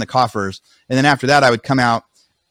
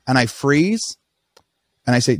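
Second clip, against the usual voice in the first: normal talking voice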